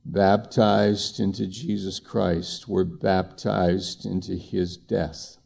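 slow, solemn reading of the verse; scripture reading. Acted. Pastor reading Romans to the congregation, slow and solemn. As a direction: let each word of scripture land slowly.

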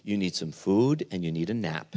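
hushed, tender voice for a worn-out prophet; tender, like to a baby. Acted. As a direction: coax him gently like a tired baby. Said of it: "like he treats a little baby".

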